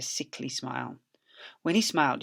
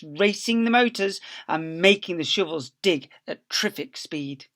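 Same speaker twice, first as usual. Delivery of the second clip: plain reading voice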